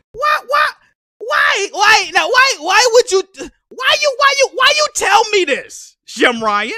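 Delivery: high, loud repeated why; outraged rant. Real, not acted. Streamer ranting at Jim Ryan, high outraged shouting. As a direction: demand answers in outrage.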